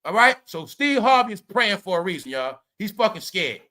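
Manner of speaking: usual speaking voice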